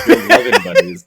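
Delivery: teasing echo breaking into laughs; laughing mockery. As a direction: blurt the quote loudly through laughter. Mocking the reading through laughter.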